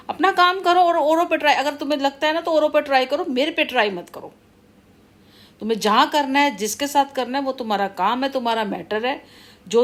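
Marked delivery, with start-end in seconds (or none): 0.1-4.3 high-pitched, heated rush of words; worked-up, insistent back-off warning; angry warning. Real, not acted. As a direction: tell them off, pitch jumping up. Telling the wrongdoer to back off.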